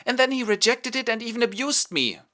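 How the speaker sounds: high, loud aggrieved whine; whiny courtier. Acted. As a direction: complain like a wounded schemer.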